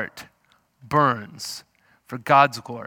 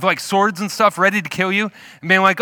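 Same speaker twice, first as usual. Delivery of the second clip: ordinary delivery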